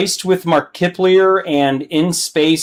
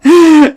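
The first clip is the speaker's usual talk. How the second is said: burst of laughter; 7 dB louder than usual. Real, not acted. Laughing at the meme.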